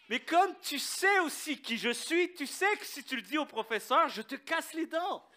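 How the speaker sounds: jokingly threatening to break his teeth; mock threat. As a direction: tease him with a fake threat. Mock threat to friend, laughing.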